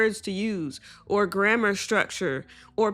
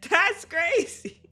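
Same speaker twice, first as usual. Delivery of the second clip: laughing, higher and slower; laughing disbelief; 7 semitones higher, slower than usual. Real, not acted. Laughing at people using AI as a doctor, incredulous.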